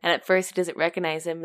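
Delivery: ordinary delivery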